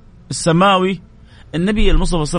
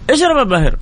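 gentle urging; 10 semitones higher than usual. Acted. Prophet urging Abu Hurayra again.

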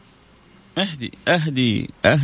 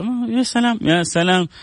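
delighted; 12 semitones higher than usual. Real, not acted. Delighted after reciting.

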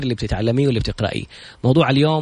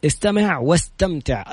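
usual speaking voice

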